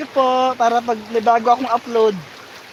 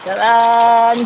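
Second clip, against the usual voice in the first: loud; 2 semitones lower, 8 dB louder than usual. Real, not acted. Jumping into the river.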